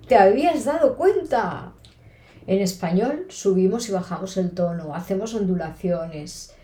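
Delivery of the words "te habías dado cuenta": higher, louder and happy; joyful. Shown as a demonstration. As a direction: sound delighted as you ask. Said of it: "si quiero demostrarte alegría". (pregunta alegre, más aguda y fuerte)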